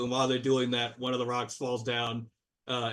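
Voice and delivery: ordinary delivery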